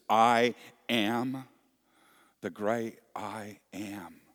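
I AM punched out loud, the great I am slowed; loud stress on I AM, then slow and weighty; emphasis on I AM. Shown as a demonstration. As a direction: hammer the words I AM like a preacher. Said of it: "did you see and hear that emphasis".

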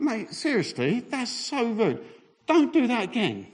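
high-pitched indignant scolding; acted telling-off. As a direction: scold him in mock outrage. Retold telling-off of chip thief.

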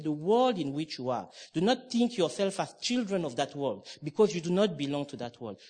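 ordinary talk

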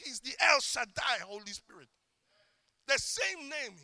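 ordinary talk

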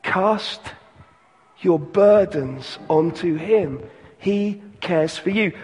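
ordinary talk

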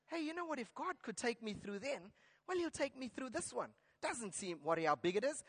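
acted private pep talk, hushed; quiet self-reassurance. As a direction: reason it out softly to yourself. Acts believer reassuring himself with faith.

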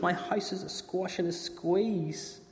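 put-on high, slow despairing old woman; slow lament. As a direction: draw it out in despair.

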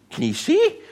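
"see" pulled up high in disbelief, ending on a rise; incredulous question. Retold or imitated. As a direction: ask "Can he see?" in mock disbelief. Pharisees questioning the parents.

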